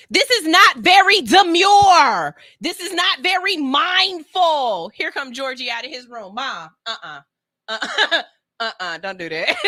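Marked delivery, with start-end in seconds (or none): 0.1-4.9 slow, loud, sing-song demure TikTok; demure trend voice. Retold or imitated. Mom scolding daughter in trend speak, slow and loud. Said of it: "become a living TikTok". 6.3-9.6 embarrassed son. Retold or imitated. Son objecting to mom's trend talk.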